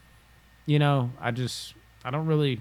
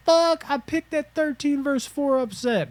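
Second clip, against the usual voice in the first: much higher, thrilled fan squeal; excited fan; 4 dB louder than usual. Retold or imitated. Imitating a bracket fan thrilled by his upset pick. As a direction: celebrate the upset pick in a high voice. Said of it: "there is times where we're like".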